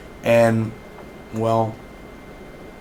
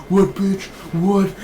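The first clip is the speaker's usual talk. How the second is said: shocked outburst; 8 semitones higher, slower than usual. Retold or imitated. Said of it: "He's like".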